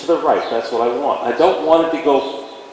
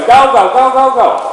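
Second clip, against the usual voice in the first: high, loud nagging go go go; mock the trainer who won't stop chasing the horse; mocking pushy handler; 7 semitones higher, 5 dB louder than usual. Mocking handlers who chase their horse, in a loud nagging voice. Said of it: "got my stick out here going".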